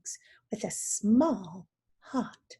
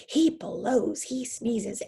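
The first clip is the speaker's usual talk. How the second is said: ordinary delivery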